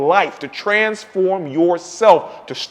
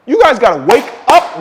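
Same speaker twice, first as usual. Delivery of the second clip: ordinary talk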